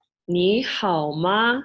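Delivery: slow "你好吗" with each tone drawn out; Mandarin pronunciation. Shown as a demonstration. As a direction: teach "你好吗" syllable by syllable. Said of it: "you would say".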